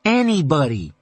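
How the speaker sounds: "Anybody" said again, firmly and defiantly; defiant repeat. Acted. Son repeating his point to his mother, defiant. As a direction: dig in on "Anybody". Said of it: "he repeated defiantly".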